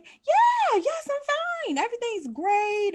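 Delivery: fake fine. Mother insisting to the doctor she is fine, forced cheer. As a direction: push the brave face too hard. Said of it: "her forced reply to doctor".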